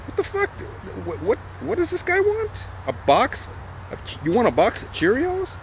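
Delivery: high-pitched disbelief, way up in the voice; astonished high-pitched what the fuck; incredulous squeak. Retold or imitated. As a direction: squeal it in shocked confusion. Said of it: "He's like".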